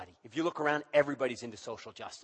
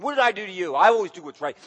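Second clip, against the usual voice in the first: loud, aggrieved "What did I do to you?"; mad God; 4 semitones higher, 9 dB louder than usual. Acted. God confronting the people, wounded and angry. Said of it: "He's mad".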